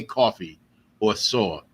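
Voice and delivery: New York vowels on coffee and saw; New York accent. Shown as a demonstration. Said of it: "my accent has, has flattened out".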